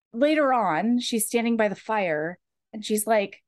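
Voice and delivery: ordinary talk, nothing marked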